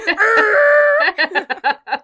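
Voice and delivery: long, high, loud tire squeal; imitated car screech. Friend joking about driving away from baby shower, screeching.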